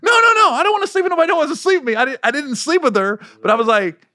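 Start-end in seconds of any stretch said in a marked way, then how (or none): 0.0-2.0 high, rushed loud denial; frantic protest. Retold or imitated. Storyteller refusing to sleep with anyone, frantic protest. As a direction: protest in a high-pitched hurry.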